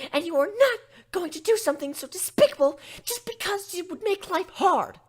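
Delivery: very high, loud stern scolding; a timid pony suddenly yelling a stern command; furious shout. Acted. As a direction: lay down the law with fury. Fluttershy furiously forbids abortion.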